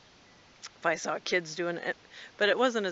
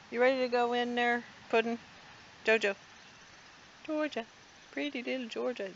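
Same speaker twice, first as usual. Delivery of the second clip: pet talk; 6 semitones higher than usual. Real, not acted. Talking sweetly to the cat.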